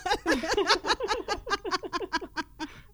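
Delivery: loud, high open laughter; burst of laughter. Real, not acted. Cracking up at the trip invitation, loud laughter. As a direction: let a real laugh break out.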